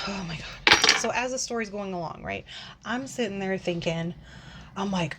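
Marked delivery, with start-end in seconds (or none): none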